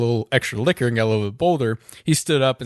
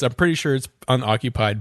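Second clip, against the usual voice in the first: ordinary talk, nothing marked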